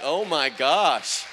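loud, drawn-out amazement; amazed exclamation. Retold or imitated. Preacher retelling her reaction to a healing, amazed. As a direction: belt it out slowly in astonished delight.

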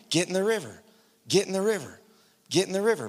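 ordinary delivery

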